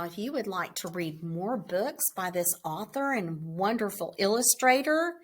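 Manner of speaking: ordinary delivery, nothing marked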